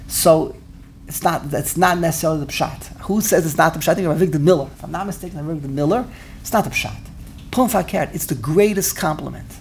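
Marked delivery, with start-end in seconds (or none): none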